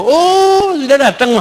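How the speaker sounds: high, drawn-out "oh" of a wife on her phone; high-pitched mock wife's greeting; distracted wife. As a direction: mimic an absorbed wife's offhand welcome. Wife on phone greets husband absently.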